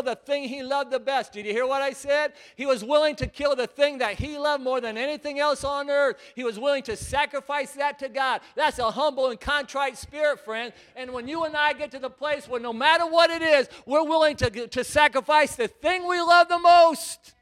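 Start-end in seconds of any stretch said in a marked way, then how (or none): 2.6-8.4 loud, higher preaching shout; shouted emphasis on willing. Real, not acted. 11.0-17.3 louder and higher, slowing at the top; preaching climax. Real, not acted.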